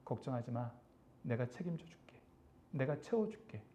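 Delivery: very soft, low, hushed comfort; God's gentle reassurance. Acted. God answering a wronged believer's complaint, gentle reassurance. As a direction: drop right down and reassure very quietly. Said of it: "그런데 하나님이 뭐라 그래요?".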